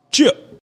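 sudden squeaky yelp of a word; high yelp. Real, not acted.

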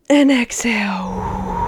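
slow, airy breathed-out cue; slow guided breathing whisper. Shown as a demonstration. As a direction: let the word ride the long exhale. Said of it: "So I'll do an example".

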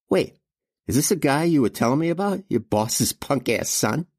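high-pitched sneering thug voice; Junior, scornful henchman. A narrator's reading. Junior asks his brother about the boss's son, high and scornful. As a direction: play the big little brother, pitched well up.

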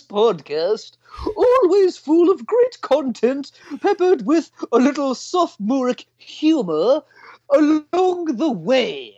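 high, slow put-on English accent. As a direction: read the review in a posh English accent. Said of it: "an English, English accent".

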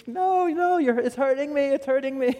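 high mock scream at normal volume; imitated child's scream. Toddler held down for tweezers, imitated screaming. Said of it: "and he's screaming".

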